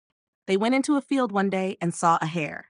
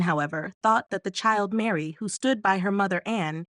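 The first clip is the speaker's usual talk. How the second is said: ordinary delivery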